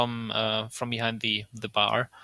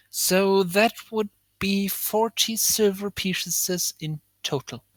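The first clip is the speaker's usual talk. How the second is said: slow, higher, measured tally; drawn-out price quote; 7 semitones higher, slower than usual. Acted. Tiefling host totals the bill for customers, slow and drawn out. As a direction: spin out the sum as if adding it up.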